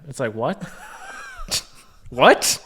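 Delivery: ordinary talk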